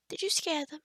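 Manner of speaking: ordinary delivery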